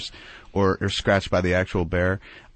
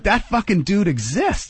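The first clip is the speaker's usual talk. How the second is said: amazed; 10 semitones higher, slower than usual. Retold or imitated. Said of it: "you're like".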